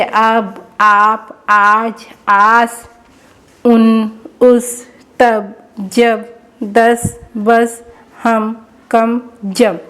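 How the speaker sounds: very slow, drawn-out words; slow reading demo; slow sounding out. Shown as a demonstration. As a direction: stretch each word for the class. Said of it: "बार बार practice करवाएं".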